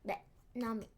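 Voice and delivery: whispered and stammering. Real, not acted. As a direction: stumble over the words quietly. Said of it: "Ça bégaye".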